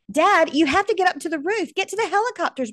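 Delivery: high, loud, pleading cry to get up; urgent shout. Acted. Son begging his trapped father to reach the roof, desperate shout. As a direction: yell it at him like his life depends on it.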